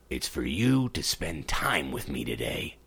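a little frog asking shyly; small frog. Acted. Frog asks the princess to spend the day with him, low and soft.

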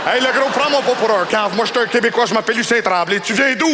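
hint of a gruff local; rough Québécois customer. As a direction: play the customer calling himself Lucien Tremblay. Customer insists, Lucien Tremblay.

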